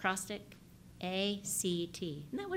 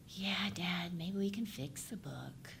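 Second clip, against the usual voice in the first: put-on small whispered kid's voice; soft, timid whisper from the child; 6 dB quieter, slower than usual. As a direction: whisper it like a shy boy. Child's last line in story.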